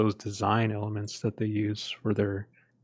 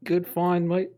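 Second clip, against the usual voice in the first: bad Australian accent, high and loud; hammy Aussie "good find, mate"; 8 semitones higher, 4 dB louder than usual. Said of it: "my worst Aussie accent ever".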